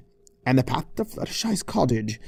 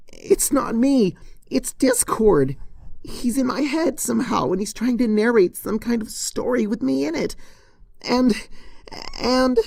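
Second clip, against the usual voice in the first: desperate pleading; 11 semitones higher than usual. Acted. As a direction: beg them, voice pitched up in panic. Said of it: "pleading desperately with the crowd".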